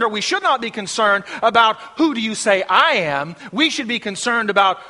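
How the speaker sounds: urgent, driving pulpit delivery; forceful preaching. Real, not acted. Preacher telling the flock it's His impact, forceful preaching. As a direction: preach it out with emphatic punches.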